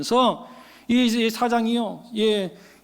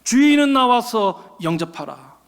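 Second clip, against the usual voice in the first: acted ceremonial call; loud herald's cry. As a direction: announce the emperor like a court herald. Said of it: "바깥에서 크게 외치는 소리가 있었습니다".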